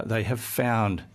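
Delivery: usual speaking voice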